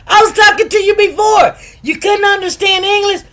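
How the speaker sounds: high-pitched exasperated telling-off; parent scolding. Acted. Parent to child who finally listened, exasperated scolding. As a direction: scold hard, pitch jumping right up.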